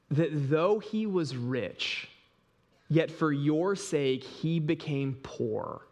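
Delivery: slow, measured reading of the verse; scripture reading. Acted. Pastor reading the verse to his congregation, slow and solemn. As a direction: lay each phrase out with long pauses. Reads scripture aloud slowly.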